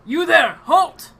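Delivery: shouted command from a sentry; guard ordering halt. Acted. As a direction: call out sharply to stop an intruder. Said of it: "tell you to halt".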